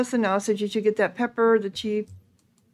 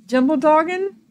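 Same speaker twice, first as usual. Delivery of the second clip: higher, questioning rise; puzzled echo; 6 semitones higher, 3 dB louder than usual. Real, not acted. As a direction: repeat it as a baffled question. Queries the odd item name.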